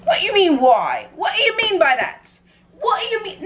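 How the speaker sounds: high-pitched, playful incredulity; hammed-up affronted questioning; mock indignation. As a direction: squeak out your disbelief, swooping way up.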